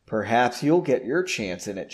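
ordinary delivery

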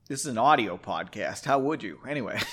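ordinary delivery

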